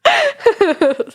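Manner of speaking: breaks into high laughter; laughing. Real, not acted. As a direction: laugh through the words. Funny mishearing of B wing.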